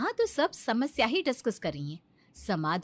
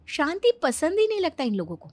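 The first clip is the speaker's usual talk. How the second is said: ordinary talk, nothing marked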